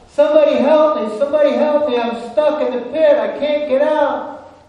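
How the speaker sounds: high, loud, urgent plea for help; crying out. Acted. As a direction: shout frantically from the bottom of a pit. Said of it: "he cries out".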